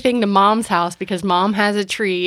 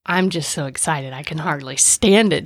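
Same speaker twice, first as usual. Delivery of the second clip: low, understated fake excitement; deadpan mock enthusiasm; 4 semitones lower than usual. Grump co-host claims to be excited for the movie, deadpan. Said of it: "Don't you hear the enthusiasm in my voice".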